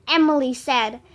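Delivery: ordinary delivery, nothing marked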